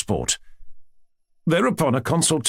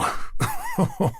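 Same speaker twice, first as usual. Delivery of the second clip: a sudden high burst of laughter; hearty laugh. A narrator's reading. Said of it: "laughing and putting his hand".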